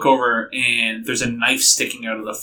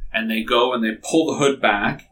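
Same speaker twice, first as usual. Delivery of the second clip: ordinary talk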